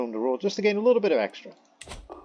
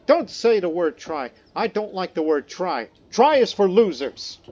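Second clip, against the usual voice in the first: higher-pitched, hammed-up scolding of the word try; mock callous scold; 6 semitones higher, 5 dB louder, slower than usual. Vlogger imitates callous people lecturing about the word try, hammed-up scold. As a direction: sneer at quitters like a callous coach. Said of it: "some more callous people, they would say".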